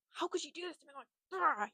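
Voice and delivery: high-pitched pretend tirade; mock angry rant. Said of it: "have a massive go and be like".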